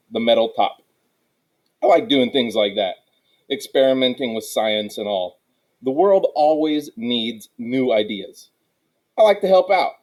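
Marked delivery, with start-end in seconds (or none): none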